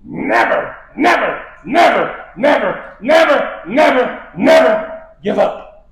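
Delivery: rising shouts of never, the last line slow and drawn out; Winston Churchill. Retold or imitated. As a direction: growl each never like a defiant old war leader. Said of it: "in his old gravelly voice".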